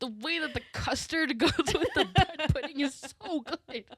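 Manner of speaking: high, loud, laughing gush; laughing rave. Real, not acted. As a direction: rave while cracking up. Raving, laughing about dessert.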